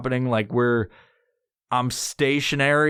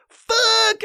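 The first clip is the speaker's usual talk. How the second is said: exasperated shout; 5 dB louder than usual. Retold or imitated. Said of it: "I was like".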